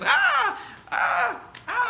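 very high imitated screaming; mock kid scream. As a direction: squeal like a terrified child in the air. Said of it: "they're just, freaking out".

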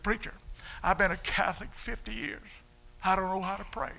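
put-on high, tearful voice of an old man about to weep; tearful father. Father telling the preacher he never prayed, near tears. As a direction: choke up as your father confessing he can't pray. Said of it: "he began to sniffle".

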